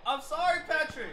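loud, high apology yelled at the screen; shouted apology. Real, not acted. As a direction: yell your apology to the player.

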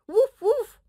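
high, loud happy woof woof; little dog barking with joy; puppy barks. Acted. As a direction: bark like an excited puppy.

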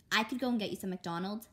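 usual speaking voice

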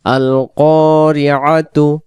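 আল কারিআতু chanted slowly, ending on a clear tu; pausal reading. Shown as a demonstration. Teacher shows the pause point: slow chanted আল কারিআতু. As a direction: recite আল কারিআতু slowly, voicing the final tu. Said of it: "এইখানে দম ছেড়ে দেব".

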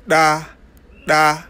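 "da" voiced and glottal, tongue at the teeth; dental implosive. Shown as a demonstration. Teacher modelling a Pulaar consonant, dental implosive "da". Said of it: "dental, sonnant et glottal".

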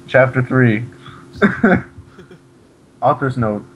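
usual speaking voice

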